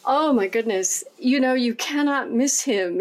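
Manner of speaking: usual speaking voice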